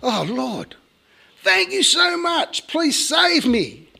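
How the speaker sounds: very high, drawn-out plea; desperate pleading. Retold or imitated. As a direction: beg for rescue in a high wail. Man begs to be saved.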